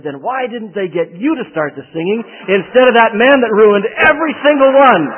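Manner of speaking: retold furious lady, climbing to a high loud peak; indignant scolding. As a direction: imitate the woman scolding him, building to a shout. Woman jabs finger, scolds him.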